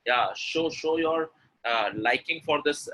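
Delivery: ordinary delivery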